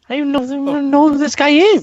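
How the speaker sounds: high, fast baffled outburst; baffled and exasperated. Retold or imitated.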